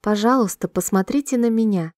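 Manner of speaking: ordinary talk, nothing marked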